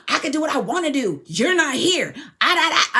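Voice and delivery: hammed-up argumentative shout; imitated angry outburst. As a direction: mimic her snapping back, loud and high. Retells her opponent cutting her off.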